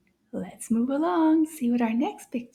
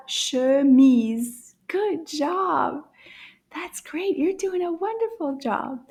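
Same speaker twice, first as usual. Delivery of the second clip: ordinary talk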